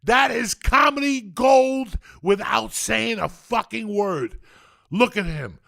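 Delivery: loud, high, shouting delight in a comedy scene; ecstatic raving. Real, not acted. Man raving to friends about Pee-wee's dance, shouting with glee. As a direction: hammer home your love for the scene, near shouting.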